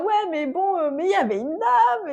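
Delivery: imitated worried mother. Retold anxious mother's protest.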